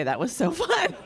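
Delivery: laughing through the words, up high; a real laugh breaking in mid-line. Real, not acted. Thanking for birthday fireworks.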